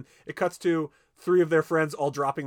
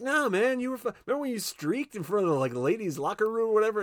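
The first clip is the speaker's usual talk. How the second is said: lightly voiced buddy; 7 semitones higher than usual. Old classmate teases the dad.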